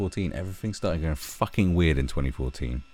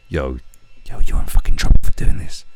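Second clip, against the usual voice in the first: much louder, breathy warning yell; mock warning shout; 5 semitones lower, 14 dB louder than usual. The friend warning Greenie about his post, loud mock yell. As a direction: shout the warning like a panicked mate. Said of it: "that's the one going".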